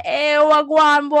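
slow, loud, hammed-up Jamaican accent greeting. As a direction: greet him like Jeffrey in a mock Jamaican accent. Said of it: "He sounds like he got a Jamaican accent".

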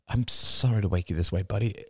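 father gently soothing his crying son. Acted.

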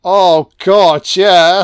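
ordinary talk

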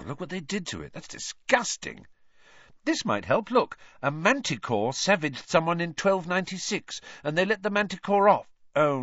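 put-on brisk bookish girl reading her finds aloud; eager schoolgirl. Hermione reads cases aloud.

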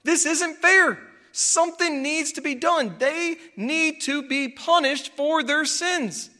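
high, loud, drawn-out indignation; infuriated outcry. Acted. Believers seeing sinners live blessed lives, acted outrage. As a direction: cry out for punishment, hitting each phrase hard. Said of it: "that infuriates us".

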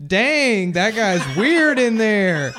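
loud, high mock disbelief; loud fake surprise. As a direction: act shocked loudly for others. Pretending to discover weirdo.

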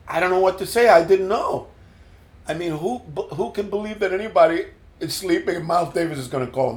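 high, flustered pleading. Retold or imitated. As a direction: babble an apology in a panic. Panicked apology to producer.

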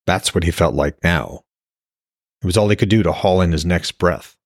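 plain reading voice

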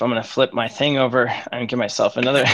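ordinary talk, nothing marked

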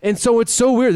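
pitched high with disbelief; rising indignant disbelief; incredulous. Real, not acted. Telling his cohost the quote was recorded, incredulous.

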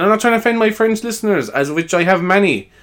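high-pitched mock French accent; cartoon Frenchman, pitched well up. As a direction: put on a hammy French accent. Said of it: "he's like stereotypical French".

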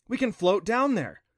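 bright, eager and high; excited. Acted. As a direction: tell them the plan with a thrill. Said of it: "she said excitedly".

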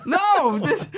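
high, loud, laughing through the words; laughing protest. Real, not acted. Guest laughing about the Kermit-voiced actor, laughing protest. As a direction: giggle while pleading your case.

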